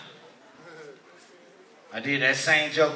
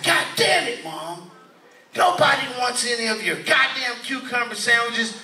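higher-pitched mock annoyance; pissed-off son; 6 semitones higher than usual. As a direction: play a mildly hammed-up exasperated rich kid. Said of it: "he get pissed".

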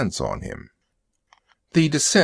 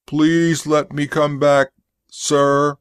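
put-on high monotone childlike begging; infantile robot pleading; 4 semitones higher, 3 dB louder, slower than usual. A narrator's reading. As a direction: beg like a simple-minded robot, on one raised note. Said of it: "It sounded a little infantile".

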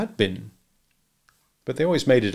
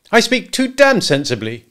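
loud, high, irritated comeback; angry retort; 11 semitones higher, 8 dB louder than usual. A narrator's reading. Husband answering wife's plea for sense, irritably. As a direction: snap back at her.

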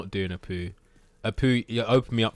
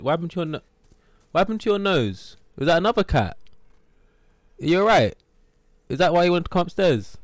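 high, louder concerned coo; pet talk; 7 semitones higher, 5 dB louder, faster than usual. Real, not acted. Streamer asking his cat about its nose, high pet-talk voice.

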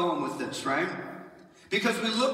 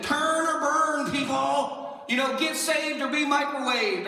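hellfire preacher parody; 6 semitones higher than usual. Pretend.

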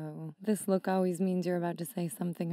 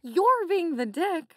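scolding; 8 semitones higher, 4 dB louder than usual. Acted. Scolding Dave.

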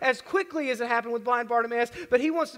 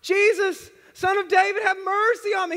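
loud, high desperate cry for mercy; yelling; 8 semitones higher, 7 dB louder than usual. Retold or imitated. Blind Bartimaeus crying out to Jesus, loud and high. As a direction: call out over the crowd at full voice. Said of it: "He's yelling to Jesus".

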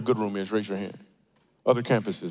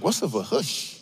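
mock kid; 3 semitones higher than usual. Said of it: "Some of the kids are like".

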